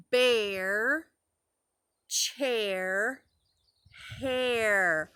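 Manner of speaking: "bear", "chair", "hair" stretched, long pauses between; rhymes sounded out. Shown as a demonstration. Teacher helping preschoolers hear it, rhymes sounded out. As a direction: say "bear, chair, hair" one by one, rising, rising, then falling. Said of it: "They all rhyme".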